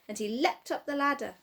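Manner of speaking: ordinary delivery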